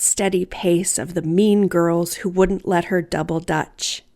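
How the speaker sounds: usual speaking voice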